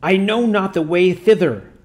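a hint of a whining demon stalling; groaning demon. A narrator's reading. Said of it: "groaned the demon".

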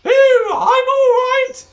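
very high, loud, slow warble; dotty old teacher. Acted. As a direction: call out cheerily from under the tuba. Teacher calling from under tuba.

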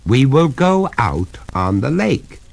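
insist, each word measured; firm. A narrator's reading. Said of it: "said the master firmly".

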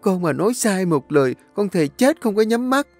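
high, pleading, tearful wail; bursting into tears. A narrator's reading. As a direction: beg for mercy through sobs. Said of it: "nó liền khóc òa lên".

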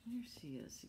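hushed whisper. Real, not acted. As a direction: point her out in a whisper. Pointing to picture.

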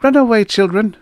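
high, loud, airy dismissal; young woman's high, nonchalant brush-off. A narrator's reading. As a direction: shoo the children off carelessly. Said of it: "she said nonchalantly".

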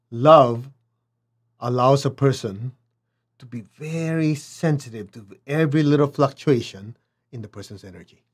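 normal talking voice

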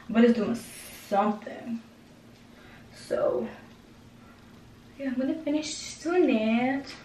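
muffled through fingers; partly covered mouth; hand over mouth. Real, not acted. Seen 1: Hand intermittently covers mouth while massaging face; mouth visible earlier.

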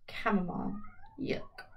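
plain reading voice

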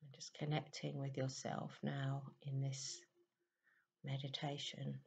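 very soft, unhurried meditation voice with long pauses; guided meditation. Real, not acted. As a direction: gently guide listeners into stillness, hushed and even.

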